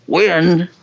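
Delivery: sharp, demanding one-word question; demanding mother. Retold or imitated. Mother calling from the top of the stairs, demanding. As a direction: fire it at the child like a demand. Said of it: "she'd go".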